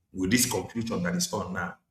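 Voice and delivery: ordinary delivery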